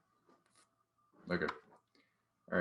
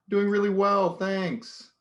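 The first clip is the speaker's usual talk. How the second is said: much higher and louder, sing-song and sarcastic; high mocking voice; 13 semitones higher, 8 dB louder, slower than usual. The first character mocking the grammar correction, high and loud. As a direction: squeal it out to mock the pedant.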